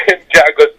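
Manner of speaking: ordinary talk, nothing marked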